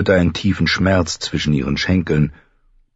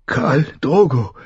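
fearful; 9 semitones higher, slower than usual. A narrator's reading. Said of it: "brachte sie hervor ... voller Furcht".

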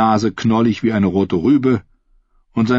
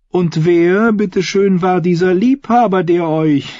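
high-pitched queen, prying playfully; teasing queen; 11 semitones higher, 4 dB louder than usual. Acted. Queen asking Taena about her lover, high teasing voice. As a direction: ask it as a queen fishing for secrets, pitched up.

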